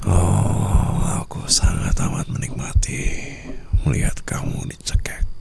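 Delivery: slow whisper, leaning on sangat; whispered delight, sangat stretched out; gloating whisper. Acted. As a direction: savour every word of the threat. Savouring the choking.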